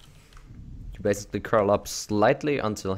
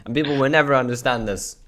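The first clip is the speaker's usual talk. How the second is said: loud, higher, emphatic rant; exasperated; 5 semitones higher, 8 dB louder than usual. Real, not acted. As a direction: insist loudly, fed up with being misunderstood. Exasperated argument over slider names.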